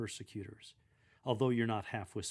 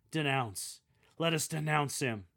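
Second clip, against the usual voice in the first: "Let us denounce him" stronger than "Denounce"; second denounce stronger; 3 semitones higher, 4 dB louder than usual. Shown as a demonstration. Lector coaching readers on the persecutors' line, building. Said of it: "making the second denounce stronger than the first".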